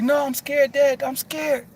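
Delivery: imitating a frightened child, pitched high; mock child fear, high and pleading; scared son. Son tells dad he's scared, imitated high child voice. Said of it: "And he was like".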